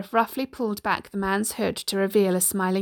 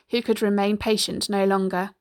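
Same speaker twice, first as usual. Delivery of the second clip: plain reading voice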